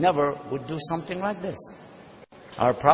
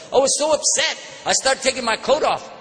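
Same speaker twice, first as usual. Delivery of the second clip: heated, raised retelling of his fury; worked-up anger; 8 semitones higher than usual. Retold or imitated. Preacher reliving his anger at the insult, heated.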